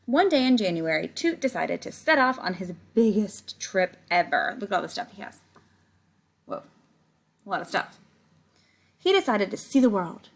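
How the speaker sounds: reading a picture book aloud to the class; story reading. Acted. Teacher reading the book to her class, storytelling voice. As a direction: tell it like a storybook for kids.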